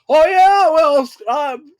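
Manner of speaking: high, loud sputtering comeback; hammed-up teenage outburst; mock defiance. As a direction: blurt a big rebellion that fizzles out. Acts out brief teenage defiance.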